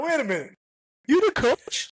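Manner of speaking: high, incredulous mock surprise; stunned disbelief. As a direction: replay the shock of learning he's the coach. Retold shocked reaction.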